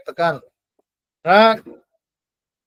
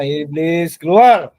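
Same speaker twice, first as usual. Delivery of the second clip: commanding shout; 3 semitones lower, 6 dB louder, much slower than usual. Shown as a demonstration. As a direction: start low, then bark out keluar loud. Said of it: "Anda tinggal bilang".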